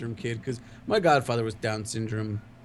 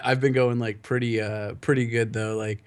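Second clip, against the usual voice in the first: usual speaking voice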